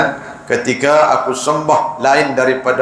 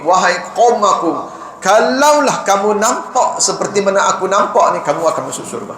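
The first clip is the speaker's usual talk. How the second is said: much higher, pleading call; impassioned preaching; 8 semitones higher than usual. Acted. Martyr calls to his people, high fervent plea. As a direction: plead with the people at full fervour. Climax of sermon, martyr's words.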